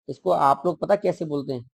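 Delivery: normal talking voice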